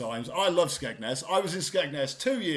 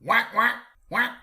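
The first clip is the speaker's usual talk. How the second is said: angry duck quacks; 6 semitones higher than usual. Pretend. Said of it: "That's an angry duck".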